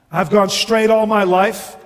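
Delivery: a touch of bluster; boastful ghost. Acted. Ghost showing himself off to the bright man, boastful.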